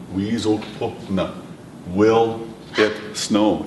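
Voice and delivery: very slow, careful; slow demo. Shown as a demonstration. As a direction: spell it out slowly. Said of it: "The question was".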